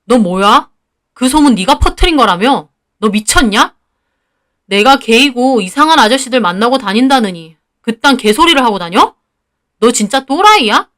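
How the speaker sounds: accusing, indignant questions; acted anger, lively pitch swings; angry confrontation. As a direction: confront the rumour-spreader with rising indignation. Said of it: "저는 너무 화가 나".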